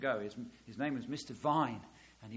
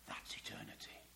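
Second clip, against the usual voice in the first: whisper; 16 dB quieter than usual. Acted. Hushed after the proclamation.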